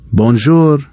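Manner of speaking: a bright, high French greeting; teacher's bonjour. A narrator's reading. Teacher greeting the class, bright high bonjour.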